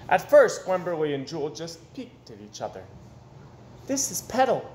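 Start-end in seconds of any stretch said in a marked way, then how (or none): 3.9-4.6 put-on higher little girl introducing her doll; shy child. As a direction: show off the doll timidly. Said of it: "said Wemberley".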